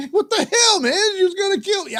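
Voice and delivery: high incredulous mock cry; mock shocked buddy. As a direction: squeal it like a stunned friend. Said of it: "And the guy's".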